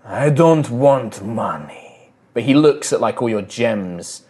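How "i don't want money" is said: very slow, drawn-out shaman voice. Acted. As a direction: refuse as a shaman, stretching every word. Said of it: "The shaman looks, and he".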